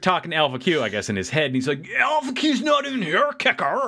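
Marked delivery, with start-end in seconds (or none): 2.0-3.9 very high, whining mock-idiot voice; mock dopey idiot. Ironhide baffled by Kicker, high mocking idiot voice. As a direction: play him as a clueless fool, pitched way up.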